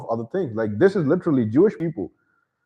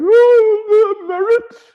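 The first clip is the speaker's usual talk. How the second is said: very high, loud falsetto sigh; mocking naive liberal; 9 dB louder, much slower than usual. Mocking colorblind Europeans, a shrieking high swoon. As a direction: swoon over the word like a naive fool. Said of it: "Europeans who are like".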